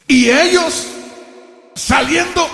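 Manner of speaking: high-pitched proclamation, word by word; emphatic scripture reading. Real, not acted. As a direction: proclaim the verse with pauses.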